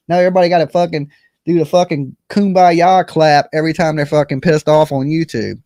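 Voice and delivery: usual speaking voice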